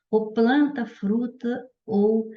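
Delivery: usual speaking voice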